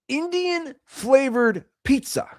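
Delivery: high, slow mock disbelief; mock incredulous. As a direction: say it like it can't be real. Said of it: "Let me repeat that".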